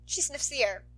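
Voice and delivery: ordinary delivery, nothing marked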